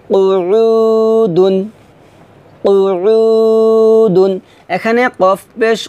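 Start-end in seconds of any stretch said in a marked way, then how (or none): 0.1-4.3 কুউদুন chanted very slowly in a long recitation tone; drawn-out Quran recitation. Shown as a demonstration. Teacher chanting কুউদুন slowly for students to repeat. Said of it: "হরকতের উচ্চারণ তাড়াতাড়ি করে পড়তে হয়".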